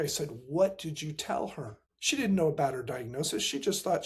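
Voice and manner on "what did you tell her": ordinary delivery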